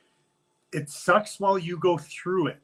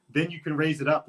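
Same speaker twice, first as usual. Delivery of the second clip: ordinary talk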